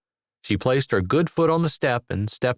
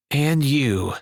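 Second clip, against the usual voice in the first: raspy; 4 semitones higher than usual. A narrator's reading. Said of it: "A raspy note had entered her voice".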